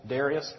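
Darius said DAIR-ee-us; DAIR-ee-us pronunciation. Shown as a demonstration. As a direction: stress the first syllable of Darius, like "dairy-us". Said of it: "as I like to say".

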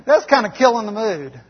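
loud, laughing aside at the interruption; amused outburst. Real, not acted. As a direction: burst out laughing at the mic check. Mic test interrupts sermon climax.

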